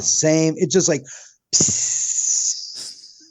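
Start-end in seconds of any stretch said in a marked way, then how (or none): 1.5-3.2 unvoiced air-leak hiss; deflating hiss. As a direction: let the air out like a tire. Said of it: "all the air was deflated".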